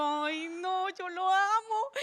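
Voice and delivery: drawn-out lovesick sigh, slow; mock-swoon like a teenager in love; swooning girl. Telling her mother about the boyfriend, slow lovesick swoon. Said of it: "Y yo".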